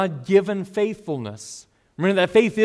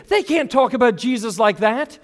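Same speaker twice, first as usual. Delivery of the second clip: high, loud mock protest; indignant outburst, put on for show; mock indignation; 7 semitones higher, 6 dB louder than usual. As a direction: play the offended believer, raised pitch. Mimics offended believers he rejects.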